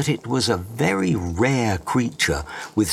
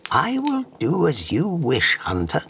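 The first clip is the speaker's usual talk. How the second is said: high otherworldly fairy bug; enchanting magical creature voice; 7 semitones higher than usual. Acted. Bug grants wish.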